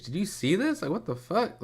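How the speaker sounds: pitched high, incredulous; surprised disbelief. Real, not acted. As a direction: react as if you can't believe your eyes. Reacting to cops on stage.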